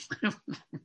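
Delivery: quiet suppressed laugh; stifle a laugh under the breath; soft breathy giggle. Real, not acted. Preacher caught laughing mid-reading, stifled giggle. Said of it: "sorry about that".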